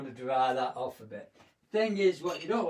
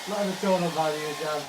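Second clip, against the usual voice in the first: raised voice over a hair dryer; 4 dB louder than usual. Real, not acted. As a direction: speak up over the dryer noise. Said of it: "I'm not talking over the air dryer".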